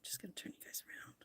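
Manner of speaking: fast hushed whisper. Real, not acted. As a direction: whisper it quickly. Whispering off to the side.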